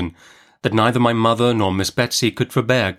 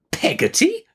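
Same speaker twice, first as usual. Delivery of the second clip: very high, incredulous rising question; indignant. A narrator's reading. Aunt repeats the servant's odd name, indignant. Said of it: "repeated Miss Betsy with some indignation".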